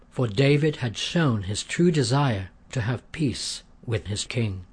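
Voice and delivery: usual speaking voice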